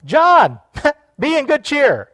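loud, high mock encouragement; pastor's cheery urging. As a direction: call out like an over-eager pastor. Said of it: "if the pastor came up to you and said".

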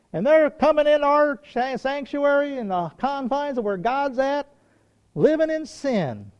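high, slow mock outrage; scandalized board member. Board to pastor about sinners in church, scandalized. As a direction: draw out the complaint in high-pitched disapproval.

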